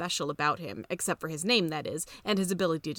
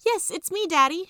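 put-on much higher, raised girl's call; girl calling back; 10 semitones higher, 6 dB louder than usual. A narrator's reading. Daughter calling back to her father, high raised girl voice. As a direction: answer him brightly across the platform. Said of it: "she called back".

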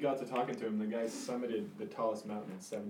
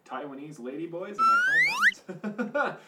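mock rich guy voice; 8 semitones higher, 9 dB louder than usual.

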